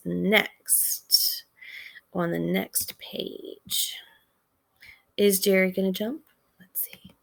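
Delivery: whispered, slow, teasing suspense. Real, not acted. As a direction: hush the class and make them curious. Teacher builds suspense before next page.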